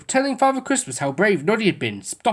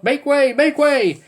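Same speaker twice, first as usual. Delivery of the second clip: calling out; 9 semitones higher, 4 dB louder, slower than usual. A narrator's reading. Policeman keeps calling.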